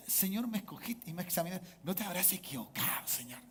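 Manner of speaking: murmured question to God, dropping to a whisper; hushed doubting prayer. Acted. Believer questioning the Lord's choice, hushed and doubtful. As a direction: ask it very softly, almost whispering.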